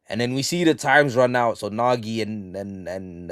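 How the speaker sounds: ordinary talk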